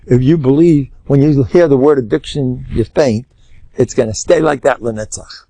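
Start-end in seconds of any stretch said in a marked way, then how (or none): none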